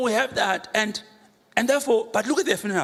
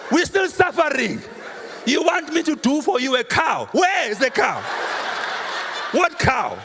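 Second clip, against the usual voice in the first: laughing, fed-up shout at grandma's spirit; exasperated outburst; 5 semitones higher than usual. Acted. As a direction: throw your hands up and yell you have nothing. Exasperated reply to angry ancestor.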